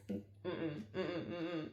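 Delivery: muffled, soft mm-mm, mouth held shut; quiet humming no through closed lips; closed-mouth refusal hums. Acted. Mum acting out holding vomit in her mouth, muffled refusal.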